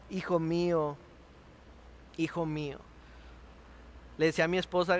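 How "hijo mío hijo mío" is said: fading lament. Acted.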